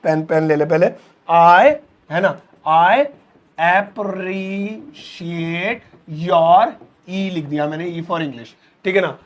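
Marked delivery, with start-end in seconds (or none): 3.0-6.7 very slow word by word; I appreciate your stretched very slowly; slow dictation. Shown as a demonstration. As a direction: write it out slowly. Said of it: "हम बोलेंगे I".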